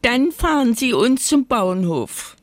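high, mildly plaintive potato; understated high-voiced woe; sighing. A narrator's reading. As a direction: lament it with a touch of a sigh. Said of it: "seufzte ihre Kollegin".

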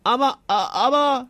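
startled knight's stutter; acted, flustered stammer. A narrator's reading. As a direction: trip over the word in dismay. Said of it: "stotterte er".

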